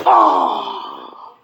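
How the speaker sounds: sudden low far-off yell; cartoon scream. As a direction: yell as you're blasted away. Sent back, explosion.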